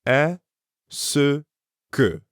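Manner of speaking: "Est-ce" and "que" split with a pause; syllable by syllable. Shown as a demonstration. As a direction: model it slowly for repeating. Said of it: "Repeat after me".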